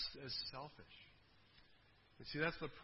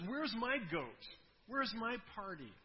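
higher, slow whiny demand; self-pitying demand; 6 semitones higher, slower than usual. Retold or imitated. Elder brother demanding his party, mock whiny. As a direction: whine like a spoiled son. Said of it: "it's all about him".

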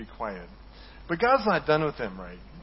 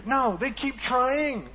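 exasperated; 9 semitones higher, much slower than usual. Real, not acted. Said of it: "It's like, you're so stupid".

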